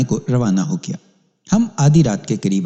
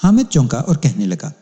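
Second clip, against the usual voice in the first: plain reading voice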